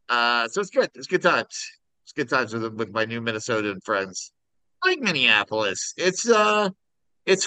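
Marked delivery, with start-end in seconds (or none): none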